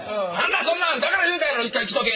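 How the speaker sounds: nagging mum voice; mother scolding. Retold or imitated. Mother scolding in retold quarrel.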